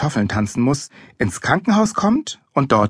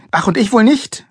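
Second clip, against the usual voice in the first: high, louder, stung retort; indignant snapping; 11 semitones higher, 5 dB louder than usual. A narrator's reading. Mother to weary father, snapping. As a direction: fire it back, offended. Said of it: "fauchte Mama".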